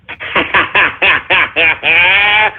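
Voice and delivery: long staccato mock laugh; drawn-out, deliberate ha-ha-ha; put-on laugh. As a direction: laugh showily after a boast. Boastful laugh after his declaration.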